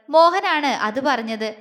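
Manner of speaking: ordinary delivery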